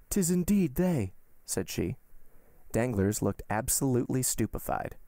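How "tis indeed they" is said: high, slow exclamation; shocked recognition. A narrator's reading. Baroness seeing her own horses outside, shocked recognition. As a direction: draw it out with raised pitch.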